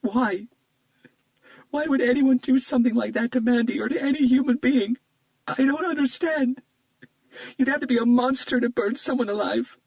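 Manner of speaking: grieving friend breaks down sobbing. Acted. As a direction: cry out in anguish through tears. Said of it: "started sobbing".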